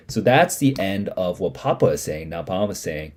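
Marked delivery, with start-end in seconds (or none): none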